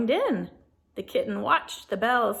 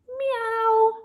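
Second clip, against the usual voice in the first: kitten meow; 13 semitones higher, 6 dB louder than usual. A narrator's reading. Kitten answers at the window.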